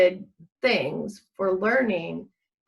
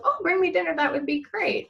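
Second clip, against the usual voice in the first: eagerly raised pitch, delighted; eager yes; 8 semitones higher than usual. Retold or imitated. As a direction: leap at the offer, pleased. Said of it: "He goes".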